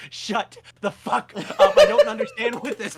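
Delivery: loud, rising laugh-through rant; exasperated laughing outburst; laughing exasperation. Real, not acted. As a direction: vent with laughter breaking in. Venting frustration laughing.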